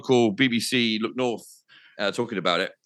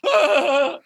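high, loud yelp of terror; panicked scream; 5 dB louder than usual. Retold or imitated. Swept up in the glider on air, panicked yelp. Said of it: "And I'm like".

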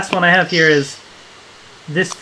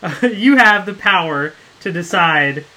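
usual speaking voice

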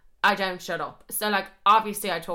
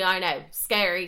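normal talking voice

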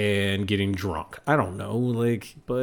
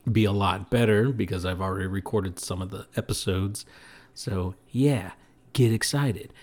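ordinary delivery

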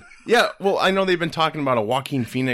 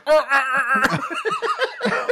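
laughing snort noises; 5 dB louder than usual. Retold or imitated. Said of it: "She's like".

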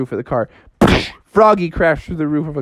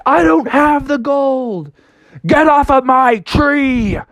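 slow high father; 9 semitones higher, slower than usual. Acted. Father refuses.

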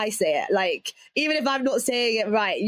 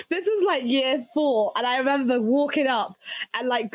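ordinary talk, nothing marked

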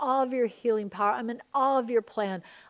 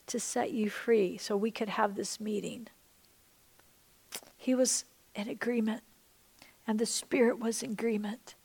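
hushed prayer whisper. Acted. Pastor guiding the congregation to picture God, whispering. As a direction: whisper it gently, like a secret.

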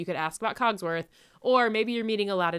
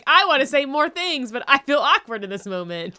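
louder, higher mock-awkward outburst; 7 semitones higher, 7 dB louder than usual. Guest stuck for words with a character, awkward. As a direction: blurt it out as if awkward. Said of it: "I just feel so awkward".

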